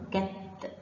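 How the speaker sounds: short كت with a released, vowelless t; sukun on ta. Shown as a demonstration. Teacher shows children the sukun, bare t ending كت. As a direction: close كت on a bare t. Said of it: "سامعين صوت التاء عليها سكون؟".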